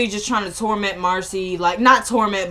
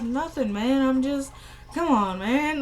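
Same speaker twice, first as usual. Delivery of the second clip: soft, slightly raised stoned voice; floating high man; 3 semitones higher, 6 dB quieter than usual. Retold or imitated. Ben high, answering Eddie, floating. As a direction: imitate someone drifting on drugs, quietly. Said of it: "he's floating".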